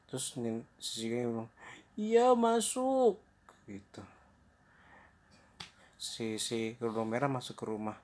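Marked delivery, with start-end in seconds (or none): 2.0-3.2 very high, slow, drawn-out granny call; fake grandmother. Wolf disguised as grandma answers door, fake granny voice. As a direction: stretch it out as a pretend grandma. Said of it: "menyamar jadi neneknya".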